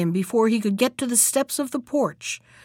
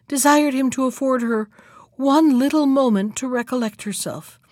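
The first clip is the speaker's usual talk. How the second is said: plain reading voice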